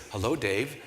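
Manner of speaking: low, soft voice on the phone; caller's voice. Retold or imitated. Gala boss calls him by phone, low and soft. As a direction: imitate an unimpressed caller, low and measured. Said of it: "this voice says".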